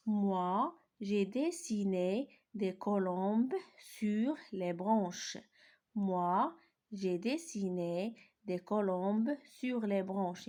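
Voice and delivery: "j'ai dessiné des colombes" read very slowly; slow dictation reading; slow model sentence reading. Shown as a demonstration. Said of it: "Je lis les phrases".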